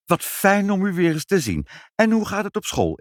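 high-pitched effusive visitor's greeting; gushing greeting. A narrator's reading. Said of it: "en riepen".

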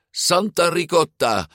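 high-pitched cry of amazement; astonished exclamation. A narrator's reading. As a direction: exclaim in shock at the sight. Girl exclaims on seeing monster. (verbaasde uitroep)

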